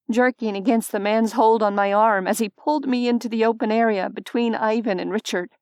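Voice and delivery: usual speaking voice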